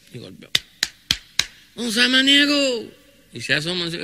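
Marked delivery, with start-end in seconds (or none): 1.8-2.9 much higher, louder call, drawn out slowly. Acted. Visitor calling the healer from the gate, loud and drawn out. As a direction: call across the yard to the house.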